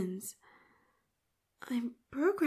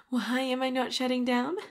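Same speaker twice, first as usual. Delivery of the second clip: louder, triumphant question; gleeful louder taunt; 6 dB louder than usual. Acted. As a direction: crow about not shutting down. Realises shutdown failed.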